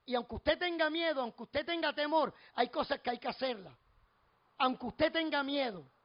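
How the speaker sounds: preaching. Real, not acted.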